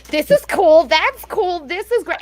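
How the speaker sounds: put-on high, delighted little-boy voice; excited kid. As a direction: imitate a child marvelling at everything. Mum voices son admiring hospital.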